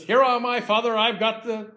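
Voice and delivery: eager son. Acted. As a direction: announce yourself brightly to your father. Jacob poses before his father.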